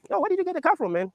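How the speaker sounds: high mock-surprised question; surprised friends. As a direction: pitch it up, amazed friend. Friends ask where car came from.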